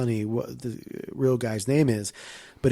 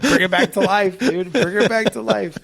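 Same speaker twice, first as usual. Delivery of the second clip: higher, louder excited repeat; 8 semitones higher, 3 dB louder than usual. Real, not acted. Cheering the return of a character to a friend, excited and loud. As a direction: get fired up about it.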